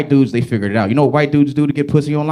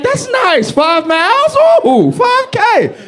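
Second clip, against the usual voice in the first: high, drawn-out mocking ladies voice; lightly mocked woman shrugging off five miles; much slower than usual. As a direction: sing it up high like an unimpressed lady. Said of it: "ladies are like".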